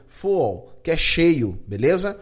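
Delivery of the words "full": full with a dark final l; English full. Shown as a demonstration. Teacher drilling full for students. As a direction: say full with rounded vowel and dark l. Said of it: "nada de u".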